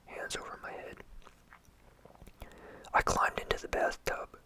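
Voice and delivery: usual speaking voice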